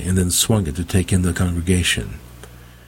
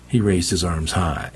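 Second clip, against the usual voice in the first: ordinary delivery, nothing marked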